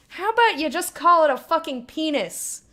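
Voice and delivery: loud, exasperated rant at chat; exasperated outburst. Real, not acted. Streamer scolding chat over silly wording, loud and exasperated. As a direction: blurt it out, fed up.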